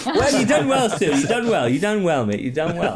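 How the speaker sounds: high, loud, mock proud mentor voice; hammed-up praise. Game master praising Stu in a silly voice. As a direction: bellow the praise like a proud coach.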